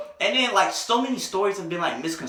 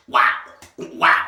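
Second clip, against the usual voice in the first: mock rapper's ad-lib; a lone, drawn word as a rapper's tag; 5 dB louder than usual. Storyteller reacts to being called ugly, rapper impression.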